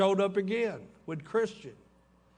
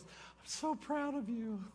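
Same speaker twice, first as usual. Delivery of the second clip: tender; 6 semitones higher, 9 dB quieter, slower than usual. Retold or imitated. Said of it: "She goes".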